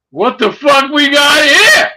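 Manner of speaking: loud, high, slow retold shout; booming challenge. Best friend walking in on the thief, booming challenge. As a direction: burst in hollering, stretching the words.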